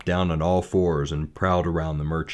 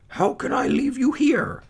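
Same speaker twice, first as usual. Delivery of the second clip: high, pained plea; anguished father. Acted. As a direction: refuse to leave her.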